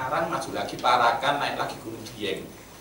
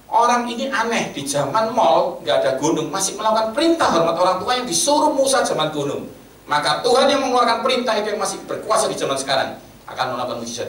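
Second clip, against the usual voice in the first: preaching at full force; 9 semitones higher, 8 dB louder than usual. Acted. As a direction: proclaim it to the whole hall. Klimaks khotbah yang berapi-api. (khotbah berapi-api, suara tinggi dan keras)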